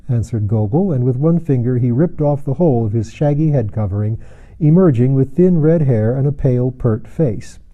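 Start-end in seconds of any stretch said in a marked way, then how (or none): none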